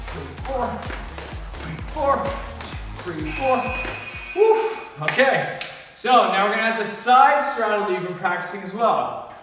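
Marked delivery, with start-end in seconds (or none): 4.4-4.8 high woo; excited cheer. Real, not acted. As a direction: cheer the finish. Coach cheering after drill.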